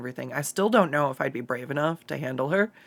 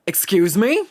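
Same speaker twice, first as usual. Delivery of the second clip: louder, caught-off-guard mock reply; mock startled "Excuse me?"; 4 semitones higher, 8 dB louder than usual. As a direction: play the puzzled asker, loud. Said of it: "And they're like".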